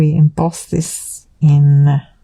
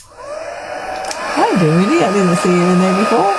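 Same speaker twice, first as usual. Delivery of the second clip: high stretched cooing hello; sing-song pet greeting; 6 semitones higher than usual. Real, not acted. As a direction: coo to a pet.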